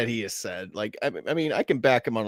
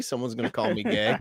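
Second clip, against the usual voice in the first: ordinary talk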